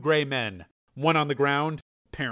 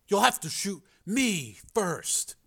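slow, higher-pitched character voice; resolute Joe; 8 semitones higher, 3 dB quieter, slower than usual. Acted. Joe dares the two armed girls, resolute. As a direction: lay down the challenge deliberately.

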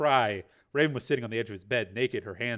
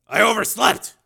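startled; 8 semitones higher, 11 dB louder than usual. Acted. Surprised waking late.

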